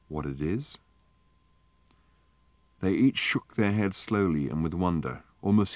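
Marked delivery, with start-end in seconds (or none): 0.1-0.8 softer, hushed question; quiet question. A narrator's reading. Wonko asks about the bowl, softly and hushed. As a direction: ask it almost under the breath. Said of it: "said Wonka quietly".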